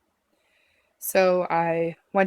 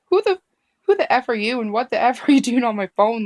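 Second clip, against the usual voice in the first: retold furious snap, higher and louder; mean angry outburst; 5 semitones higher, 6 dB louder than usual. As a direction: hurl it at the paramedic in disbelief. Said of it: "But I was so mean".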